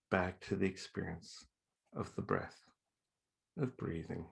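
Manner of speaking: calm meditation guide with long pauses; guided meditation. Acted. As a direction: settle listeners gently into their breathing, letting phrases fall away. Leads a breathing meditation.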